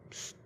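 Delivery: voiceless attention-getting "psst"; imitated quick, quiet psst; hushed psst. God nudging someone who is pressing in, voiceless psst. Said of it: "he starts going".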